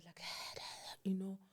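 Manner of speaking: breathy voiceless whisper, very soft. Retold or imitated. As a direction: whisper it like a shy child. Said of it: "she'll even whisper".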